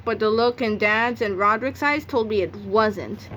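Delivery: ordinary delivery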